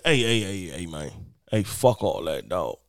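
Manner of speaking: deep dismissive interruption. Retold or imitated. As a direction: drop low and wave him off. Marvin interjecting, voiced by teller.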